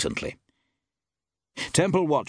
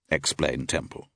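ordinary delivery, nothing marked

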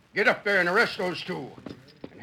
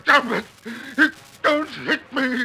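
terrified pleading; 7 semitones higher, 5 dB louder than usual. Acted. Wheeler being beaten.

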